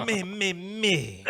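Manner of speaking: high, slow "Me, me, me" like a warm-up; stretching out each "me" up high; mock singsong. Host echoing a co-host's joke, singing "me" high and slow. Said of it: "when you said "me, me".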